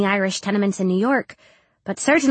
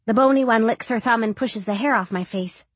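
usual speaking voice

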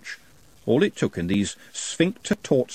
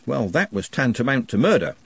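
ordinary delivery, nothing marked

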